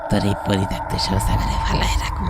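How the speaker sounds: whispered low and cold; menacing ghost whisper. Acted. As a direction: breathe the threat like a patient spirit. (ফিসফিস করে ভূতের হুমকি)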